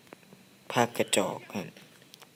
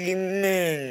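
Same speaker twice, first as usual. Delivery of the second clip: excited urging; 8 semitones higher, 3 dB louder than usual. Real, not acted. Urging himself to shoot.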